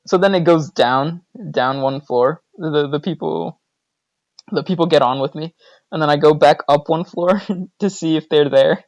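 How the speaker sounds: ordinary talk, nothing marked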